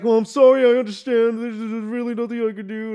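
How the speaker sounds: high, loud mock apology; mocked phone agent. Mocking retold customer service agent.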